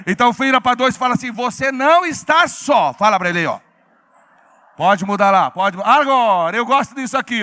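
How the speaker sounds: loud call-and-response preaching; full-force preaching. Acted. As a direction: rouse the church to shout with you. Preacher leading loud declarations. (pregação em voz alta, conduzindo a igreja)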